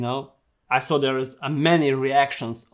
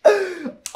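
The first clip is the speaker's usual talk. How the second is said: shrieking laugh. Real, not acted. Reacting to gross video.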